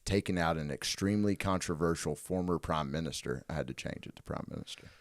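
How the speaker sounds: low, measured trailer-style read; pitch narration. Acted. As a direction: read it like a movie synopsis. Reads movie pitch synopsis.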